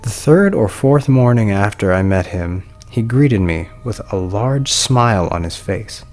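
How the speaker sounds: plain reading voice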